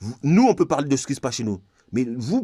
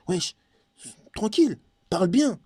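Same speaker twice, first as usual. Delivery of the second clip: mock shop owner scolding; 7 semitones higher than usual. Acted scolding of rude customer.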